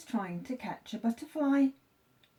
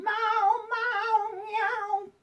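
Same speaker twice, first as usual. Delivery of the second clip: high sing-song cat meows; cat meowing; 13 semitones higher, much slower than usual. A narrator's reading. Cat at the vet still meowing. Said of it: "wouldn't stop meowing".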